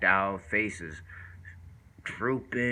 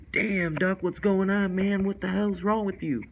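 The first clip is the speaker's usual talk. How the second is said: high, startled call to a friend; asking a worn-out friend what is wrong, high and alarmed; alarmed exclamation; 10 semitones higher, 4 dB louder than usual. Retold or imitated. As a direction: react like you just saw his yellow eyes. Shocked at friend's yellow eyes.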